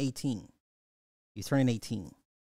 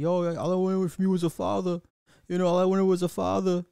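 loud, higher voice of an angry son; son yelling at mother; 5 semitones higher, 6 dB louder than usual. Retold or imitated. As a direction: call her out, raised and high. Son confronting mother in film.

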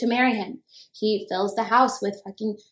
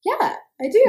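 a high, delighted "yeah, I do"; eager yes; 11 semitones higher, 3 dB louder than usual. Retold or imitated. Kim eagerly accepting the pizza date, voice leaping up. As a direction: jump at the offer with a lift. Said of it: "she's like".

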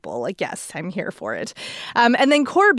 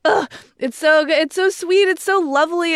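gushing; 6 semitones higher than usual. Real, not acted. Gushing about a romance book.

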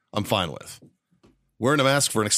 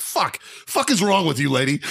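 much higher, slow, incredulous mock outrage; retold outraged yell; 5 semitones higher than usual. Man retelling confronting rude woman on plane, outraged. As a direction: demand it in disbelief at her. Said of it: "I was just like".

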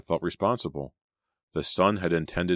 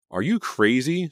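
high, loud disbelieving question; indignant cry; 9 semitones higher, 6 dB louder than usual. A narrator's reading. Selena turning down Danny, indignant cry. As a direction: throw it back at him, pitched right up. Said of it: "Selena cried".

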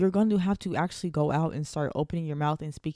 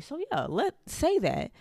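excited; 9 semitones higher, slower than usual. Real, not acted. Excited confirmation.

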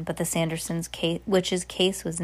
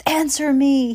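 high loud furious shout; 8 semitones higher, 7 dB louder than usual. Acted. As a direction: yell at her to answer. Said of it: "he shouted".

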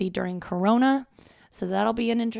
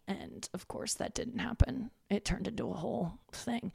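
whispered aside; 3 semitones higher, 9 dB quieter than usual. Real, not acted.

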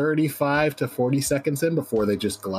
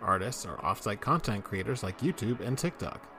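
soft announcer intro over music; podcast intro read; 9 dB quieter than usual. Acted. Host welcoming SCP fans at the show's opening, announcer read. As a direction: welcome the listeners warmly.

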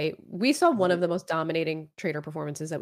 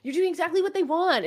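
angry Bergie; 11 semitones higher, 4 dB louder than usual. Retold or imitated. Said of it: "Bergie is big mad".

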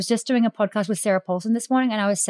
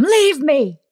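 loud, high dramatic outburst; mock scream; 6 semitones higher, 5 dB louder than usual. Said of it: "you just scream at them".

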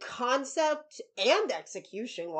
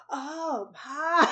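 sultry voice; 6 semitones higher than usual. Retold or imitated. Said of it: "why are they all using their sultriest voices".

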